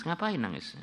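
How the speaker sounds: ordinary delivery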